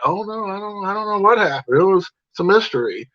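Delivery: high, playing-dumb mock voice; sing-song shrug of a fake denial; mock innocence. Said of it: "they were like".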